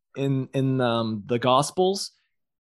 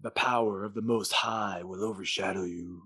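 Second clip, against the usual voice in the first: [name]; 5 semitones lower, 7 dB quieter than usual. Acted. Gabriel seducing with holy words, deep seductive voice. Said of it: "his voice gets all deep".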